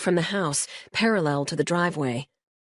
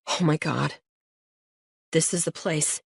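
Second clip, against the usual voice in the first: stunned. Acted. Josh doubled over in shock.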